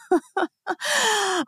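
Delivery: amused high giggle; laugh. Real, not acted. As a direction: burst into a light, high laugh. Laughing while telling story.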